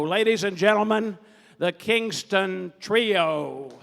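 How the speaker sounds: big, loud stage introduction; show announcer. Real, not acted. Host brings the band onstage, booming announcer delivery. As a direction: project hard, stretching out the final name.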